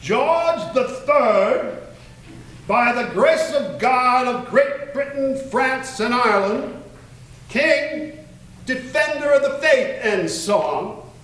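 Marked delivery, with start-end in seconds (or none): 0.0-10.0 high, slow royal-charter reading; grand formal read of a royal decree; officious proclamation. Shown as a demonstration. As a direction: proclaim it like a herald before the crown. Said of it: "make this as officious as I can".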